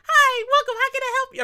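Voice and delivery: high, bright mock cashier voice; cashier greeting. Host imitating the Popeyes cashier, high mock greeting. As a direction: play the counter lady welcoming him. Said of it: "The lady's like".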